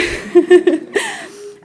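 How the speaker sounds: a short real laugh bursting out high; light embarrassed laugh; bright laugh. Real, not acted. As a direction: laugh at your own line. Laugh after her remark.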